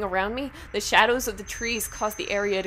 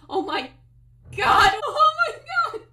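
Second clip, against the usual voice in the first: rising, much higher, louder cry of Oh my God; the horror hits all at once; horrified scream; 11 dB louder than usual. Acted. Penny spots a body on the road, screaming.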